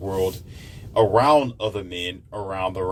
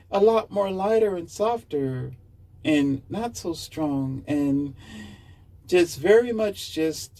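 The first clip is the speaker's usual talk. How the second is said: lighter, softer voice; 9 semitones higher than usual. Shown as a demonstration. Man demonstrating how a softened man's voice sounds. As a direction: pitch jumps way up and goes soft. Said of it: "even his voice becomes".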